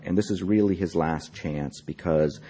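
ordinary delivery, nothing marked

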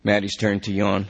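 usual speaking voice

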